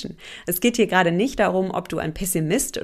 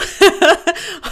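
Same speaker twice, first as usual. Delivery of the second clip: bright burst of laughter; a high, loud real laugh; 9 semitones higher, 7 dB louder than usual. Real, not acted. Laughs at own IQ point.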